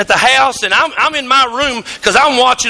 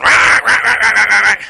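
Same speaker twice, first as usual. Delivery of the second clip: imitating duck call; 3 semitones lower than usual. Son on the porch blowing duck calls, imitated mock call. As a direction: keep the duck call going, unaware. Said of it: "he's going".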